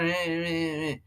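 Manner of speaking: normal talking voice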